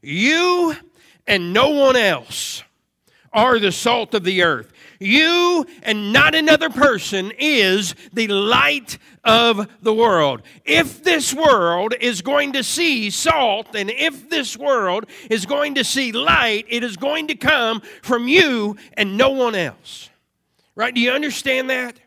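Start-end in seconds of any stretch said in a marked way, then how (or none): 0.0-10.4 forceful preaching that punches "You"; stress on YOU. Real, not acted. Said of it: "could translate it this way". 10.6-20.1 higher, forceful preaching with punched key words; emphatic preaching. Real, not acted.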